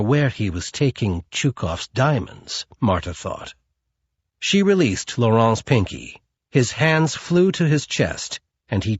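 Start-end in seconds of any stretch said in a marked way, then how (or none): none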